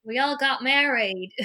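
loud, slow, badly faked accent; hammed-up bad accent, drawling it out; terrible put-on accent. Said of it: "that was a terrible accent".

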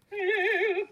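wordless high bel canto note with vibrato; high, spun operatic note. Shown as a demonstration. Singer mimicking precious bel canto, high spun vibrato note. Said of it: "kind of a high placement and spun".

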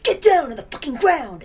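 hammed-up holdup shout; mock robbery yell. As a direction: play the tough robber ordering everyone down. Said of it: "yelling at everybody".

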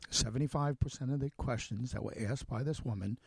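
ordinary talk

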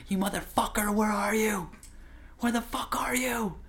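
imitating dad cursing, voice pushed way up; retold screaming at God. Dad yelling at God in the jungle, high mock scream. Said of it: "he would scream his guts out".

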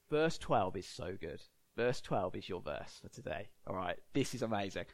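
low, soft, hushed build-up. Real, not acted. Preacher setting up the key verse for today, hushed. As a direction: drop right down in hushed anticipation.

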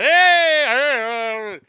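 high, loud heys, fading; a group of guys greeting a newcomer, loud then fading; guys' loud heys. Retold or imitated. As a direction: hail the room, then ease off. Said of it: "the only sound that comes out".

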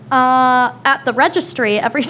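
ordinary talk, nothing marked

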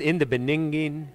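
"Beninging" for beginning, syllables muddled; bungled reading. Shown as a demonstration. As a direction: stumble on the word as "Beninging", like a poor reader. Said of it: "I think I could do it".

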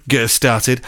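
ordinary delivery, nothing marked

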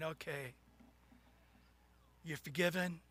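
low, quiet, lightly voiced God; amused Lord. God asks the flooded sinner, low and quietly amused. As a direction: ask gently and low, with unhurried pauses. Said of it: "the Lord's just laughing and laughing".

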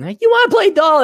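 put-on high, loud, fast child asking; chirpy kid begging to play; little girl voice. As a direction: niece voice, high and eager. Niece asking him to play.

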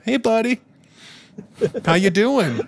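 high, chirpy placating greeting; fix-it voice. Shown as a demonstration. Greeting the scared boy, a high placating fix-it voice. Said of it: "that's my "I'm gonna fix the situation" voice".

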